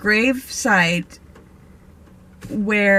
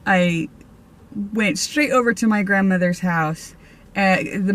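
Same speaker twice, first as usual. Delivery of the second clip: ordinary delivery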